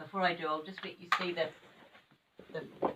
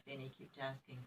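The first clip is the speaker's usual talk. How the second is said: ordinary delivery, nothing marked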